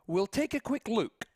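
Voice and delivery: put-on northern English Wigan accent. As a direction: say it like a Lancashire local from Wigan. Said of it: "northern accent, in a Wigan accent".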